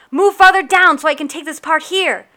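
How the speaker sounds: loud, high call from behind. Acted. Samuel calling to the narrator on the yard, loud call. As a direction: shout it up the rigging. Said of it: "a voice called from behind me".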